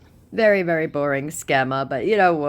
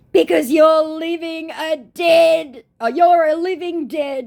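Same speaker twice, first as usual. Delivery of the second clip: high, slow put-on insult; scammer's insult mocked high and drawn out; 8 dB louder, slower than usual. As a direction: stretch it out high and mocking.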